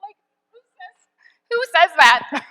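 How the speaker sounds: high, startled "who says that?" breaking into a laugh; retold stunned disbelief, laughing; incredulous disbelief. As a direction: squeak out your shock, then laugh it loud. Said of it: "And I was like".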